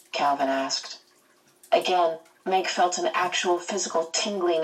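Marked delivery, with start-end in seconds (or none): none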